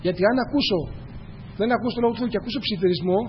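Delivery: ordinary talk, nothing marked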